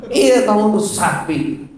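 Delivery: high, slow mock scolding; hammed-up husband's taunt; angry husband. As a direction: pile on the insult in an exaggerated voice. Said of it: "Suami mau marah apalagi".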